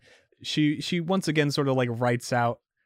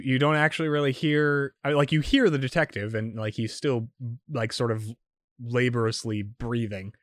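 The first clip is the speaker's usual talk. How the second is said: ordinary delivery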